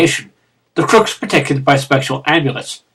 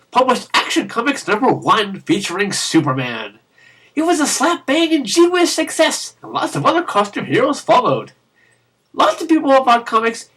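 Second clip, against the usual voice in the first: bouncy, higher storybook narration; reading to children; 5 semitones higher than usual. Pretend. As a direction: tell it like a bedtime picture book. Storybook history for kids.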